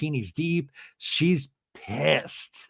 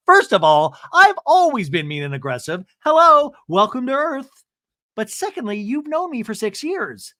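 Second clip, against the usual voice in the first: hello welcome to Earth, scornfully; indignant Emily impression; 8 semitones higher than usual.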